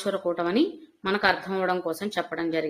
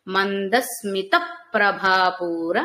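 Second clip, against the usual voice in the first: slow, with a firm push on ప్రభాపూర; correct stress; much slower than usual. Shown as a demonstration. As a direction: read Mandasmita ప్రభాపూర slowly, hitting ప్రభాపూర. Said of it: "అఘాత ప్రయోగం అండి".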